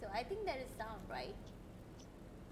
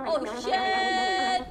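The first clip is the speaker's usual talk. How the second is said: startled; 4 semitones higher, 9 dB louder than usual. Real, not acted. Sudden game scare.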